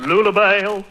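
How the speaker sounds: hammed-up suitor crooning compliments; laughing through a mock courtly proposal; overdone sweet talk; mock romantic proposal.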